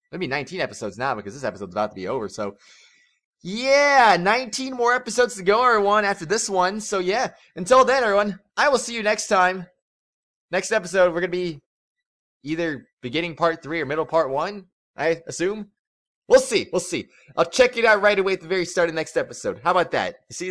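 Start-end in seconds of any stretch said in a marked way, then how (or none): none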